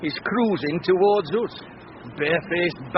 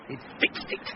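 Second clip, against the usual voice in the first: hushed, whispered and softer; whispered suspicion; 8 semitones lower, 10 dB quieter than usual. Acted. Husband to wife, whispering that the rival rigged it. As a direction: let her in on the secret in a whisper.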